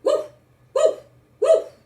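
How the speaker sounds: loud, high yapping dog barks; dog barking. Acted. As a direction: bark boldly like a protective dog. Dog Duke barks again.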